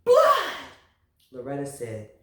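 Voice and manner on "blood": high, loud alarmed yell. A narrator's reading. Father yelling about the bloody finger. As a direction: shout it out in shock. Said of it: "Loretta's father yelled".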